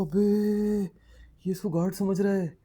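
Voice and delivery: high, loud, hammed-up disbelief; incredulous realisation. Storyteller realising his pilot friend was taken for a guard, astonished. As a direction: burst out in comic astonishment, pitch leaping.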